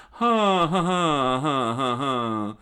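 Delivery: loud, high laugh stepping downward; hammed-up "ha ha ha"; triumphant villain laugh. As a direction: cackle with theatrical glee. Villain dances after the kick.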